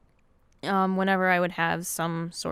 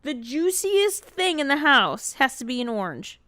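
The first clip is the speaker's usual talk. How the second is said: exasperated; 5 semitones higher than usual. Real, not acted. Disgusted at orange eating.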